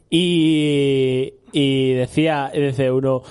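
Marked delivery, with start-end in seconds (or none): none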